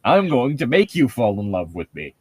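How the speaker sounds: normal talking voice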